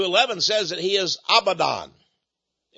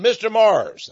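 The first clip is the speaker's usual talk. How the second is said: sharp, louder call of the name, mock anger; confronting the speaker with a jab; angry retort; 5 semitones higher, 6 dB louder, slower than usual. Angry man confronting the preacher, sharp call. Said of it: "boy, was he ever angry".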